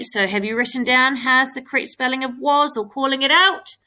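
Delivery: normal talking voice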